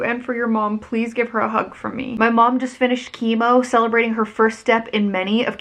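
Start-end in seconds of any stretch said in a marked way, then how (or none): none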